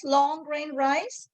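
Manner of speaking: ordinary delivery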